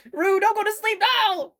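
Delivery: very high, loud call. Real, not acted. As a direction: shout across the room. Calling out to sleepy co-player.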